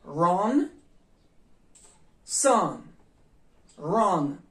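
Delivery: "run", "sun" loud with short a-like u; short u demo. Shown as a demonstration. Teacher models short u words loudly. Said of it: "овози кӯтоҳи садоноки ю".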